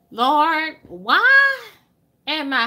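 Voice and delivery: high, rising plea to the Lord; pleading cry. Retold or imitated. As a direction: cry out to God in disbelief.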